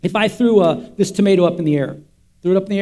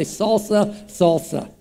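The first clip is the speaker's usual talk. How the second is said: savoring the word; 4 dB quieter, much slower than usual. Shown as a demonstration. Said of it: "You can say it twice more".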